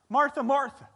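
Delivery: loud, high double call; emphatic name call. Shown as a demonstration. Showing the repeated name for extra attention, loud. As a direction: call her twice to draw attention. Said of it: "used that way to draw extra attention".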